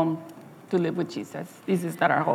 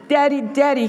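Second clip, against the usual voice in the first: put-on higher, louder excited little boy; child calling out; 7 semitones higher, 4 dB louder than usual. Boy hearing father's car, calling out excitedly. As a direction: call out to Daddy with joy.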